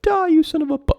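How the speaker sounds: very high, loud enraged curse; furious yell. Acted. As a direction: scream it while shooting. Officer fires at clown.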